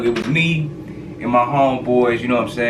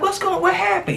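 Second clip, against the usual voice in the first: imitating frantic mother. Friend's mother running outside, high wailing mock shout. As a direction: wail it like a panicked mom.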